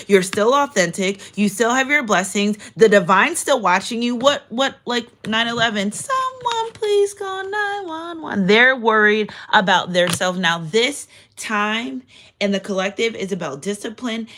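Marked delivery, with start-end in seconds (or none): 5.9-8.5 drawn-out, high mock wail; put-on damsel-in-distress cry; mock panicked plea. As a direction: wail for help in a slow, high, hammed-up voice. Mocks others' panic over their troubles.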